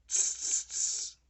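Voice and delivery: loud hissed tss, tss, tss; mocking monkeys clicking back; monkey tsk. A narrator's reading. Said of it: "and said".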